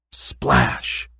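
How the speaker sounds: plain reading voice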